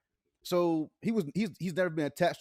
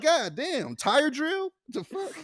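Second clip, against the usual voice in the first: mock outrage; 13 semitones higher, much slower than usual. Retelling tripping on the stairs, high mock outrage. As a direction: blow up at the stairs in mock disbelief.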